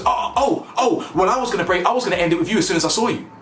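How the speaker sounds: high, rushed imitation of offence; mock indignant comeback. She hits back at him, mock indignant. Mimicking her wounded-ego comeback.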